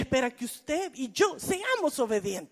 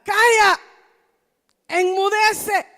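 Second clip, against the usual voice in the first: loud, high shout of command; booming rebuke to wind and sea; 7 semitones higher, 10 dB louder, slower than usual. Acted. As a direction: silence the storm with one cry.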